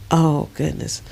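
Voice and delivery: ordinary talk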